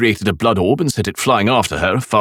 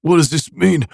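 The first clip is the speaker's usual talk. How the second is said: dazed; 9 semitones higher than usual. A narrator's reading. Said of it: "he asked in a dazed voice".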